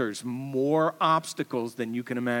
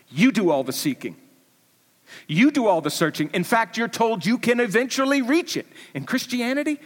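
rising, louder preaching; 9 semitones higher, 6 dB louder than usual. Acted. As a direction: press the point harder each line. Preaching at full force.

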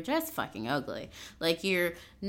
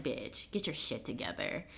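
low muttered scold; 8 semitones lower, 10 dB quieter than usual. Retold or imitated. Inner exasperated voice, low.

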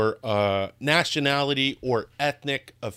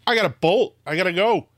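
excited; 8 semitones higher, 5 dB louder, faster than usual. Pretend. Joking about moving to Israel.

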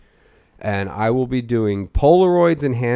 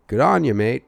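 light mock Aussie slang; a hint of ocker Australian; ordering chips in a lightly put-on Aussie accent; 3 semitones higher than usual. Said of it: "and I was like".

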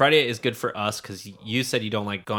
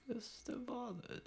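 Mister Bothers voice; 13 semitones higher, 18 dB quieter, slower than usual. Retold or imitated. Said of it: "he's a bit of an Englishman".

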